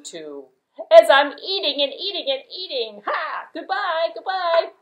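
stammer, then bid a bright goodbye; little goat voice. Acted.

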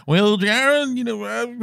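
put-on lightly sketch Bill's Southern accent, then give up; Tennessee Southern accent. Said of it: "I'm not really good at doing voices ... Tennessee Southern accent".

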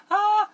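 short, loud, very high "ah"; high squeal. Real, not acted. Vlogger interrupting own makeup tutorial, loud high squeal. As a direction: let out a piercing little squeal.

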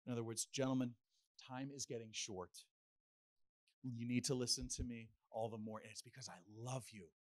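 tender reassurance. Retold or imitated. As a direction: lean in softly, like a loving teacher. Jesus's meaning retold, tenderly.

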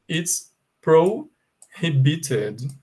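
"It's prohibited" drawn out slowly; slow emphasis. Shown as a demonstration. Teacher stressing the key word for students. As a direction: stretch out "prohibited" so it sticks. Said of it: "I want you to remember".

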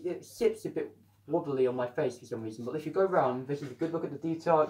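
ordinary talk, nothing marked

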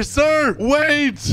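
high, urgent play-acted call. Typing to the departing cop, urgent high call.

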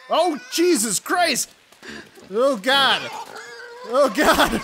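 loud, high startled cry; startled shout. Real, not acted. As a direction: jump at the sudden pig. Pig suddenly appears.

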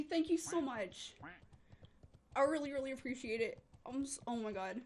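usual speaking voice

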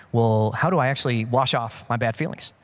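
lightly voiced questioning listener. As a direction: ask like a skeptical audience member.

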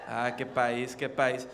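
low, softened resigned remark; weary dismay. Acted. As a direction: let it drop low, as a musing aside. Sighing aside after the bit.

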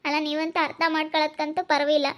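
ordinary delivery

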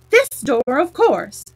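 Alice losing patience with the slow frog, irritated and loud. A narrator's reading. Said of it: "all irritation".

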